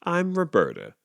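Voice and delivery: lightly chirpy goose intro. A narrator's reading. Goose introducing herself to Clyde, a light chirp. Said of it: "she chirped".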